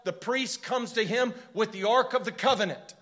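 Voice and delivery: preaching at full force. Acted. Preacher building to a peak telling of David's flight.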